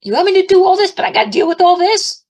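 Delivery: high, loud exasperated yell; yelling at God. Retold or imitated. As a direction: re-enact an outraged plea to heaven. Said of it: "I kept yelling at God".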